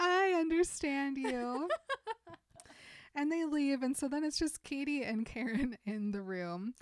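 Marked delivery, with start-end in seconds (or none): 0.0-1.8 high, slow, overly earnest; eager consoling. Retold or imitated. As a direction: lean in too sincerely. Gretchen desperate to console.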